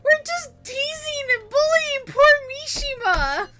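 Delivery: high, loud, slow taunting cry; gleeful shout. Acted. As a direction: crow it out to mock him.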